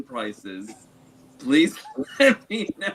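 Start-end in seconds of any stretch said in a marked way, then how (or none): 1.4-2.9 laughing. Real, not acted. Joking request while laughing.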